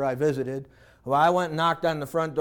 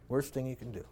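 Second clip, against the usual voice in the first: "Worst thing you can do" repeated much softer, sinking low; 6 semitones lower, 14 dB quieter, faster than usual. Shown as a demonstration. Preacher repeating his warning to parents, hushed. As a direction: drop to a grave hush. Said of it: "repeated softly".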